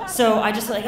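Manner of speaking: ordinary talk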